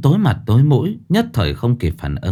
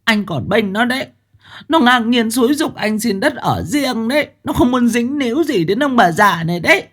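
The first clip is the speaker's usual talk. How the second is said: put-on much higher, indignant old mother lecturing; mother-in-law ranting to her son against his wife; haughty scolding; 12 semitones higher than usual. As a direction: look down on her and rant. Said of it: "nói giọng nhận giáo hoành".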